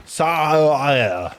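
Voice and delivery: "Sa-" stretched into a long string of vowels; consonants dropped. Pretend. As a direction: slide slowly from "Sa-" through vowels only. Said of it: "consonants are actually silent".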